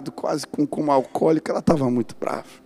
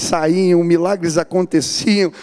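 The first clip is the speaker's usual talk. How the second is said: rising louder and higher, building to a climax; fiery preaching; 4 semitones higher, 4 dB louder than usual. Acted. Preacher rallying the church to take up God's word, building to a roar. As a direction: whip up the congregation like a revival preacher.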